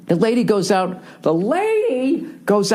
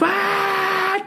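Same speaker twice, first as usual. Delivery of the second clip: mock horn blast; 14 semitones higher than usual. Game starts, imitating noise.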